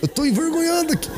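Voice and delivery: high, hurried hush to the son; flustered father hushing his son in the car; embarrassed hushing. Retold or imitated. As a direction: shush the kid, mortified. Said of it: "Eu falei".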